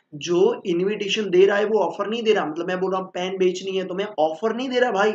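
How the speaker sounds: ordinary talk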